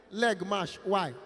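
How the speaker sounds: usual speaking voice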